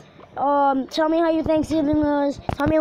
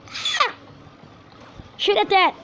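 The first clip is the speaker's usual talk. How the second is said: panicked shout; 6 semitones higher than usual. Real, not acted. Gamer panics as the tower attacks. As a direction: cry out and order the shot.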